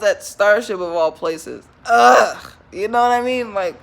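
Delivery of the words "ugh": loud groan of disgust. Real, not acted. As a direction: groan at the memory. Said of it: "makes me groan".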